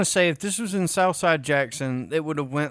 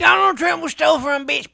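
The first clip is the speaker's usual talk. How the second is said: mock political rant; 12 semitones higher, 7 dB louder than usual. Mocking a political shouter.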